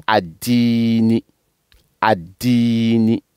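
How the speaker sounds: "Adini" detached, with opening a and long i; "Adini" starting on a clear a, then drawn-out dii; separated word. Shown as a demonstration. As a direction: say "Adini" on its own as ad-diini. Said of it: "quand on découpe, on dit".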